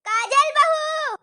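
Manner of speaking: mother-in-law hollering for her daughter-in-law; calling out. Acted. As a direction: stretch the name out, summoning her from another room.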